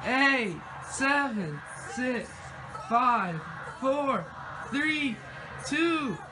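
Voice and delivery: loud, high countdown shout; new year countdown crowd. Real, not acted. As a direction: count down to midnight at full voice.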